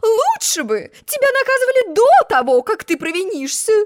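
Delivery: stern queen. A narrator's reading. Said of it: "строго сказала королева".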